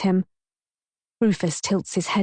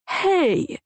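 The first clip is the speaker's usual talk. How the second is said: mock-offended outcry; 11 semitones higher than usual. A narrator's reading. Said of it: "I splutter with laughter".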